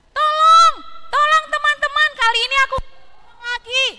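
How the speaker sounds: high, loud fake cry for help; parrot crying for help. Parrot fakes a call for help to its friends, high loud shout. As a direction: shriek for help like a tricky parrot. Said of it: "Dia teriak".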